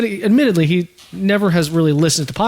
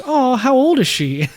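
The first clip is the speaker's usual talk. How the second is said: higher, drawn-out question; retold curious stranger; 8 semitones higher, slower than usual. Random person asking about the birthday cake, curious. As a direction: mimic a curious passer-by, slowly.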